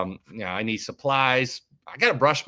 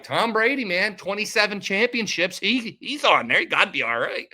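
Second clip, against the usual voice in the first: higher-pitched, impressed exclamation; retold excited reaction; 8 semitones higher than usual. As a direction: talk yourself into it, pitch raised.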